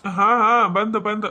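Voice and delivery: husband shouting he is coming; calling back. Acted. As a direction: answer the call from another room. Husband answers call from afar.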